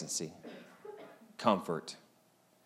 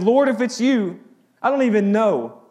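loud, high, pleading call to the Lord; a frightened disciple calling out loudly; desperate cry; 10 semitones higher, 8 dB louder than usual. Retold or imitated. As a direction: cry out as if terrified on the waves. Peter's desperate cry, retold.

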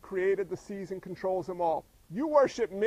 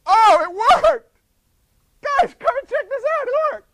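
surprised shouting; 14 semitones higher, 14 dB louder than usual. Retold or imitated. Paul imagined amazed his prayer worked, shouting high. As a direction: gush like it is a miracle. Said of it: "He's like".

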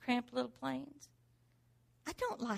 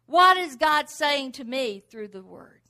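emphatic question; 4 semitones higher, 12 dB louder than usual. Acted. Preacher repeats the key question.

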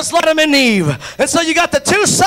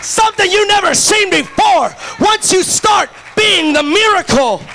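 preaching at full force; 5 semitones higher than usual. Real, not acted. Pastor to the congregation, preaching at full force. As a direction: lift the room with it.

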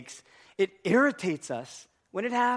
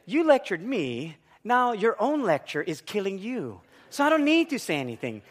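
measured, higher-pitched gloating; smug triumph; 3 semitones higher than usual. Retold or imitated. Wife silently gloating over husband's mistake, smug pronouncement. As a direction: spell out the silent look, savouring it. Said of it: "The look of".

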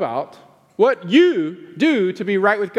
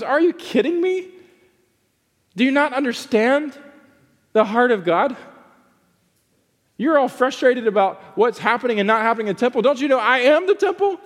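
exasperated Jesus; 5 semitones higher than usual. Retold or imitated. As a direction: voice Jesus in disbelief at the Pharisees. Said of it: "And he goes".

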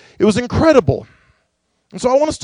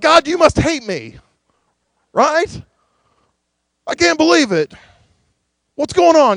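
higher, exasperated moan at God; mock complaint; 7 semitones higher, 3 dB louder than usual. Churchgoer whose car broke down, mock exasperated whine. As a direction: whine like the car just died. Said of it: "we're like".

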